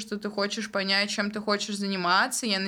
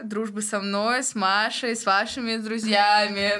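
usual speaking voice

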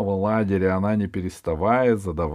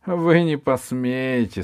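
barbarian; 6 semitones higher, 4 dB louder, slower than usual. Acted. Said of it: "сказал дикарь".

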